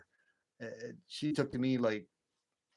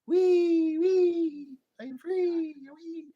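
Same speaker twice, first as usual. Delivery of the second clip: very high, loud squealing cartoon pig; Geico pig impression; 10 dB louder, much slower than usual. Man imitating the Geico pig to explain his runaway dog, squealing high and loud. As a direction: squeal it out like the pig racing off free. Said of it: "That little, that little pig".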